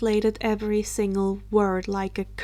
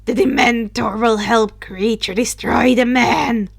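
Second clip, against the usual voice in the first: evil grin; 6 dB louder than usual. Acted. House elf gloats over his new Dementor, evilly. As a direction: savour it with a wicked grin. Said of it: "grinned evilly".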